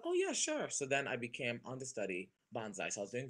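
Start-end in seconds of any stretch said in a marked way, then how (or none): 0.0-0.7 lightly acted, high-pitched agreement; a touch of keen surprise; eager yes. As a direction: jump at the offer with a high lift. Acts out accepting audition offer.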